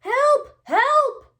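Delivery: high, loud cry of Help!; yelling for help. A narrator's reading. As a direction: shout it up high like a panicked owlet. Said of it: "he yelled".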